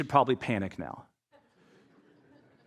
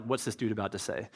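listener's wary mutter; 6 semitones lower, 9 dB quieter than usual. Retold or imitated.

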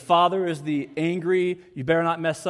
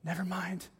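put-on hushed child; 11 dB quieter than usual. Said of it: "And she goes".

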